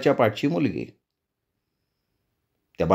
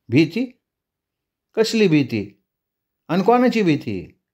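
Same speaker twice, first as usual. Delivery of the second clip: higher woman's voice, rising with scorn; strange village woman; 4 semitones higher than usual. Acted. The fearless woman throws his question back, higher and defiant.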